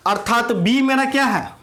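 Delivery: normal talking voice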